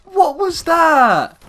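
high, loud, drawn-out cry of disbelief; incredulous outcry. Real, not acted. Gamer reacting to a shock in the match, incredulous outcry. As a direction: cry out in disbelief, stretching the words. Exclaiming at events in game.

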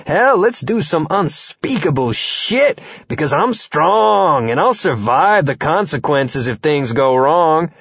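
put-on slow, cocky young witch; brash maiden voice; reckless young witch. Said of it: "The maiden says".

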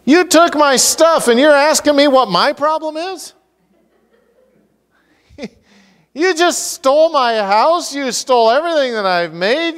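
indignant Micah. Pretend. Preacher playing Micah outraged at the thieves of his gods. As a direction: rant like a robbed man who can't believe the nerve. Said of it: "We'll give the Q interpretation to this".

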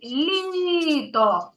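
Літо with the first syllable Лі drawn out and pushed; stress demo. Shown as a demonstration. Teacher showing a child where the stress falls, stretching Лі. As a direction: lean hard on Лі in Літо, let то fall away. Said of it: "На перший чи на другий?".

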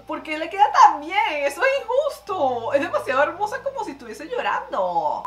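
high, loud playful protest; mock indignation. Vlogger reacting to crying makeup that looks too good, mock indignation. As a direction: complain in hammed-up envy at how good it looks.

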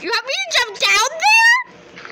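high, loud alarmed question. Real, not acted. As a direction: gasp it out in disbelief. Alarmed at a dare.

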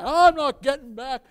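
loud, high imitated refusal; stubborn dad's yell; defiant father shouting. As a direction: holler back at the coach.

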